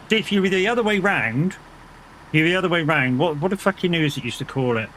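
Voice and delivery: ordinary talk, nothing marked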